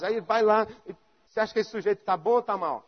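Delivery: usual speaking voice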